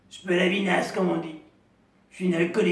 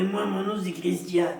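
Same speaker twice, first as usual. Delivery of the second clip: usual speaking voice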